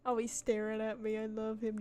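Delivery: usual speaking voice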